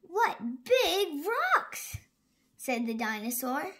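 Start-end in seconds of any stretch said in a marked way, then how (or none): 0.0-2.0 slow amazement. Acted. Dinosaur amazed by buildings.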